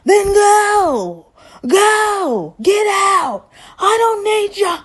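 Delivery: high, hammed-up shouting of an old man throwing her out; mock furious high shout; mock angry yell. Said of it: "Here goes Stan".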